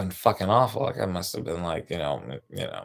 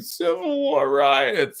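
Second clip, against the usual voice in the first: mock quote; 3 dB louder, much slower than usual. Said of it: "he was still saying".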